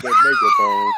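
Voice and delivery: sudden high shout. Real, not acted. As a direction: yell it out in disbelief. Roasting her looks, crowd hyping.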